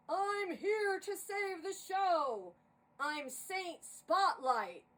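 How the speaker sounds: loud, slow, booming proclamation; grand heroic entrance. Acted. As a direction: announce yourself like a savior from the spotlight. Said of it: "our guest spoke to us".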